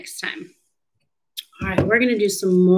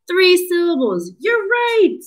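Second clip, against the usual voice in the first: high-pitched, delighted teacher cheer; excited praise; 10 semitones higher than usual. Pretend. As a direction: celebrate the correct count, voice leaping up high. Cheering children's correct answer.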